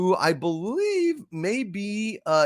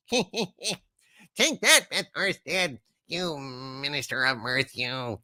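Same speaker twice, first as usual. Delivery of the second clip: ordinary delivery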